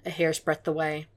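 usual speaking voice

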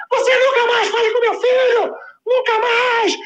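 high, crying furious shriek; shrieking tearful rage. Retold or imitated. Furious mother shrieks at the neighbor, high tearful scream. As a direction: scream it through tears, out of control.

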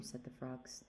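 ordinary delivery, nothing marked

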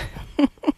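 short breathy chuckle; a small laugh at your own wordplay; light chuckle. Real, not acted. Amused by own word shoulding.

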